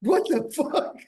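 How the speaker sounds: high, loud startled yelp of mock pain; shocked yelp. Retelling grabbing electric fences in New Zealand, shocked loud yelp. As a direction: squeal like the electric fence just bit you.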